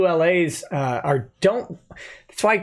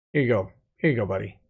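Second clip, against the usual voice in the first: quiet toast; 8 semitones lower, 4 dB quieter, much faster than usual. Real, not acted. Toasting a fan's late dad.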